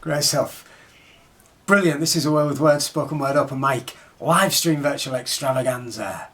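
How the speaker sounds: loud enthusiastic announcer; host announcing. Real, not acted. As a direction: introduce the next act loudly. Host announcing next performer.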